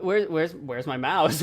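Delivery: usual speaking voice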